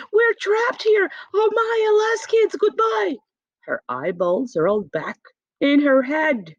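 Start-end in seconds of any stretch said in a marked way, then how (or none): 0.1-3.2 high, frantic cry of alarm; panicked scream. Acted. Teacher stranded on an island, screaming in panic. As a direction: shriek like the world is ending. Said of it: "started to scream".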